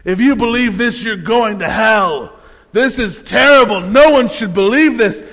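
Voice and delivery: mock fire-and-brimstone rant; preacher's condemnation. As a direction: denounce it like an angry preacher. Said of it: "He said".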